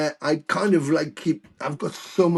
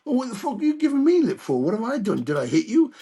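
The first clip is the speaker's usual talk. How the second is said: higher-pitched, quick mock indignation; retold comeback, jumping up in pitch at the start; indignant protest; 9 semitones higher than usual. Musician retells challenging a crying fan, mock indignant.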